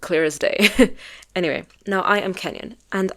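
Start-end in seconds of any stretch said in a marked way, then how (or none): 0.6-1.3 short laugh. Real, not acted. Laughs after own point.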